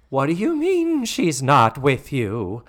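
slow, drawn-out theatrical disbelief; mock indignation. Missus Garrett questioning Joe by phone, mock indignation. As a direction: stretch the question out in hammed-up disbelief.

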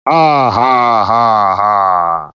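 descending mock evil ha ha; falling staged villain cackle; evil villain laugh.

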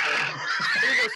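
ordinary talk

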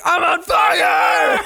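high, drawn-out yell of alarm; panicked mock shout. Stuntman set alight on cue, hammed-up high shout. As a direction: scream it long and high, hammed up. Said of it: "He's like".

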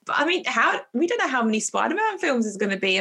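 normal talking voice

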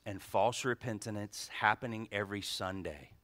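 low, soft and hushed; soft intimate preacher voice; hushed sermon opening. Real, not acted. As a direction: draw them in quietly, almost murmuring.

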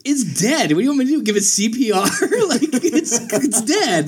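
high-pitched, exasperated disbelief with laughter creeping in; half-laughing, high comic protest; acted incredulity. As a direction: play up how absurd the question is. Retelling own incredulous reply when woken.

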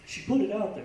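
usual speaking voice

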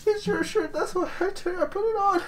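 high, soft squeaky voice of a weird intruder; creepy intruder caught in a stolen shirt, squeaking softly. Acted. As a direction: play the odd stranger in a tiny high voice.

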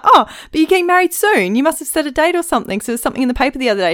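high, quick prying host voice; pry with a breathless high host voice; nosy host. Retold or imitated. Host voice in retold chat.

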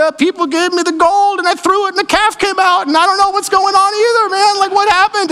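a high, panicky comic Aaron; flustered Aaron excuse. Retold or imitated. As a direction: babble like a caught kid making excuses. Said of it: "He's like".

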